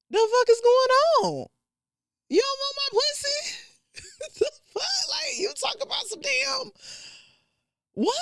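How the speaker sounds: high, squeaky what-the-hell disbelief; stunned disbelief. Retold or imitated. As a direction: play it as total shock at his question. Stunned inner reaction to date.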